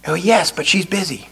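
put-on hushed, small kid voice; whispering child. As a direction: answer softly, keeping it secret. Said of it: "Still whispering".

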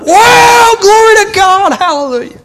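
very high loud hollered praise; excited praise shout. Retold or imitated. Preacher imitating a man shouting in church, high shout. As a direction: shout glory from the pew. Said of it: "some dude stand up".

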